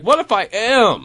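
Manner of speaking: high, slow, hammed-up worry; mock worried wondering. Storyteller admitting it kinda worried him, mock worry. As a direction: pitch it up in comic self-doubt.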